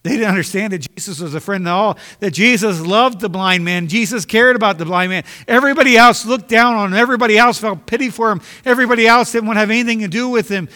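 full-voiced revival preaching; preaching at full force. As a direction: build the fire of the sermon line by line. Preaching climax.

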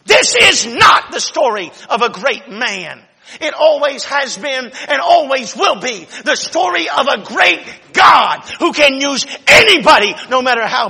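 loud, high, ringing sermon delivery; preaching at full force. Acted. Congregation hears the big opening, preached loud and high.

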